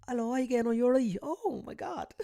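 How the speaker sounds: high-pitched hammed-up British accent; strong British accent. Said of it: "make sure your British accent is str".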